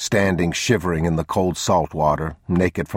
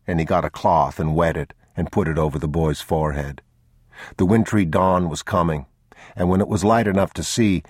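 ordinary delivery, nothing marked

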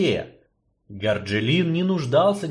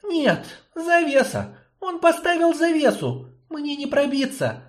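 put-on anxious young girl. Girl Yarina's voice.

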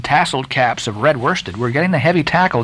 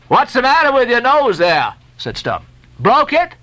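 high, raised mocking question; teasing sailor; 10 semitones higher than usual. A narrator's reading. Stubb teasing the Guernseyman about his nose. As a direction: poke fun at his nose.

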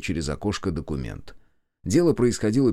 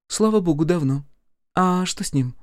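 high, louder ex-wife on the phone; 10 semitones higher, 4 dB louder than usual. Acted. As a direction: pitch it up as a tart ex-wife. (высоким голосом бывшей жены)

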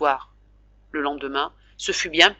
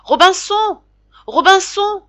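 very high, loud squawking parrot cries; shrill wailing parrot; 13 semitones higher, 6 dB louder than usual. A narrator's reading. Parrot calling its lost owner, shrill and wailing. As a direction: screech it like a desperate parrot. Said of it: "une voix stridente, éplorée".

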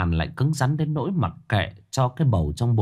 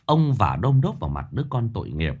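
ordinary talk, nothing marked

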